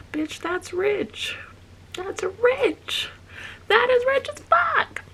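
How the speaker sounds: high, building exclamation; excited. Real, not acted.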